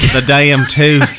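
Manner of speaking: drawled twang on "damn", slow; mimicked accent. Co-host mimicking host's damn tooth, slow drawled accent. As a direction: stretch it into a slow mock-Southern accent. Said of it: "'cause of this damn tooth".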